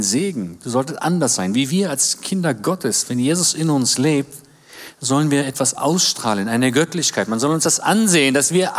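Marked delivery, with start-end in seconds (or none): none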